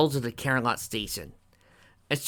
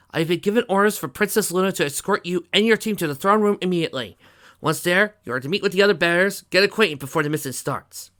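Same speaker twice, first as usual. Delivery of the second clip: loud, raised military briefing voice; bat pony guard, official; 8 semitones higher, 7 dB louder than usual. A narrator's reading. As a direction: deliver orders like a royal guard.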